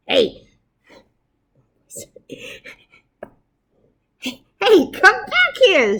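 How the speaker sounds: high, loud squeaky mouse shout. Acted. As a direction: yell after the fleeing troops. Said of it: "shouted the Big Cheese".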